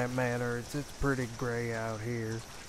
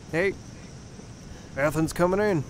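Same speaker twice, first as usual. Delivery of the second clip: loud, higher-pitched holler; calling out; 6 semitones higher, 8 dB louder than usual. Acted. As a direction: announce yourself loudly on the way in. Announcing entry into the house.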